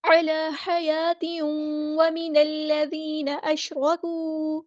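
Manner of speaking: joined through حَيَاتٍ, stopping at أَشْرَكُوا; slow melodic Quranic recitation; tajweed recitation. Shown as a demonstration. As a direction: chant it on, stop at the second mark. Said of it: "آپ اس طرح پڑھیں گے".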